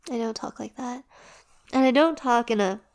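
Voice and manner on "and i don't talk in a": ordinary delivery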